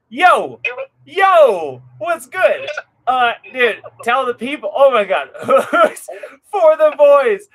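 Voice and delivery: excited. Real, not acted. Excited greeting on live stream.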